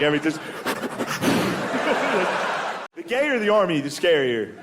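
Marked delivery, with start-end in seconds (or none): 0.4-2.9 imitated snuffling breaths, no voice; dog-like sniffing noise. Shown as a demonstration. Man breathing in woman's ear, mock dog sniffing. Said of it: "a dog starts sniffing your ear".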